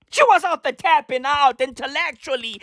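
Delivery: normal talking voice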